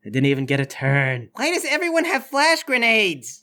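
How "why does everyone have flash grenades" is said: high-pitched exasperated yell; doctor yelling. Acted. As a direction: cry out in dismay as the doctor. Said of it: "The doctor yells".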